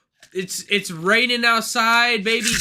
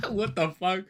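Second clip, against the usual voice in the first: normal talking voice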